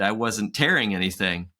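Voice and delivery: normal talking voice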